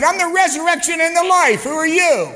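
Jesus answering the priest, loud and commanding; thundering Jesus. Retold or imitated. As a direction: fire the question straight back. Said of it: "And he said".

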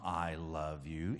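low, slow and very soft; imitated tender confession. Son thinking of telling Mom, imitated tender confession. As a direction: barely get it out, slowly. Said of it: "start to think about telling Mom".

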